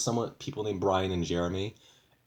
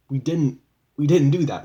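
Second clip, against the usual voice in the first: higher, louder denial; indignant protest; 6 semitones higher, 10 dB louder than usual. Real, not acted. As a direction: shout it down, outraged. Protesting false accusation.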